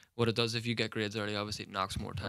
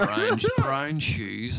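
"brown, brown shoes" dragged out slow and louder; slow emphasis; 3 semitones higher, 4 dB louder, much slower than usual. Real, not acted. Mocking culty fellas' cheap shoes to mates, slow stressed punchline. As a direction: lean hard and slow on "brown".

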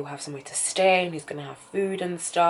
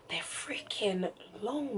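breathy whispered gripe, higher and softer; whispered complaint; 6 semitones higher, 8 dB quieter, slower than usual. Real, not acted. To viewers about hour-long episodes, whispered gripe. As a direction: hush it like a secret complaint.

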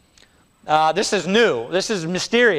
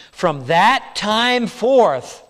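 slow scripture reading; 3 semitones higher, 4 dB louder, much slower than usual. Acted. Reads scripture slowly.

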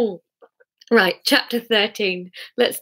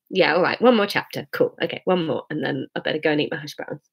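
usual speaking voice

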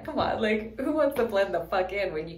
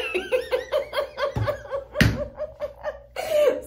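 long high-pitched laugh. Real, not acted. Cracking up at the joke to viewers, high laugh. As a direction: burst out laughing.

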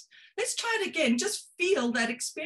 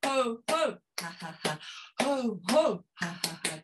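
rhythmic laughter-yoga chant, trailing off; ho-ho ha-ha-ha laughter drill; 3 semitones lower than usual. Shown as a demonstration. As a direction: chant the laugh in beats, fading at the end. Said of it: "laughter exercise demo".

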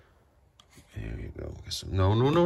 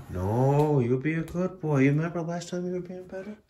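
high sing-song pet talk, a touch louder; 7 semitones higher, 4 dB louder than usual. Real, not acted. Owner coaxes the cat to behave, high pet voice.

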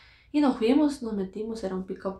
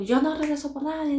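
higher-pitched imitated scolding; mildly cross, raised voice; retold angry; 6 semitones higher, 4 dB louder than usual. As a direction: quote an annoyed man's firm refusal. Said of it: "bien enojado el señor todavía".

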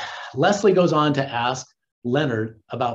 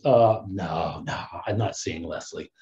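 hammed-up nonchalant denial to Penny; playing it cool; 9 semitones lower than usual. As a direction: act too cool to care, dropping low. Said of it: "Oh, now I gotta be cool".

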